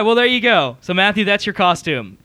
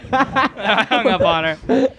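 laughing; 2 semitones higher, much slower than usual. Real, not acted. Laughing after the call.

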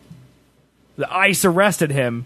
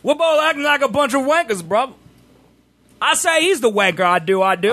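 higher, jokey British lad voice; mock British accent; 7 semitones higher than usual. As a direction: banter in a bad put-on British accent.

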